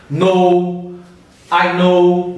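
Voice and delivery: "No" and "know" with a rounded American O. Shown as a demonstration. Teacher showing the American long O in "No, I know". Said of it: "O americano fala O naturalmente".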